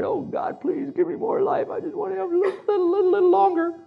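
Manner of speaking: very high mock-weeping plea; crying, hammed-up. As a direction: whimper and stammer like a begging child. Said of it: "So he cried".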